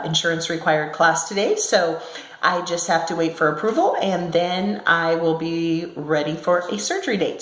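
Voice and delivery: normal talking voice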